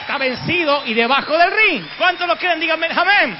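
clamor agudo y muy fuerte; predicación a gritos. Real, not acted. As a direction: proclama la victoria a todo pulmón. Clímax del sermón, pide amén. (predicación a gritos, aguda y fuerte)

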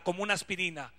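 usual speaking voice

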